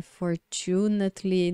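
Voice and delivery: slow "fortunately" stressed for-CHU-nat-ly; misplaced stress. Shown as a demonstration. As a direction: lean slowly on the second syllable of "fortunately". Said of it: "sílaba tônica em outra sílaba". ("fortunately" devagar, com tônica errada em TU)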